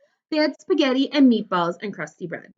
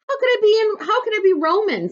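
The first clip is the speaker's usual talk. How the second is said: puzzled; 13 semitones higher, 4 dB louder than usual. A narrator's reading. Girl puzzled by dad's joke.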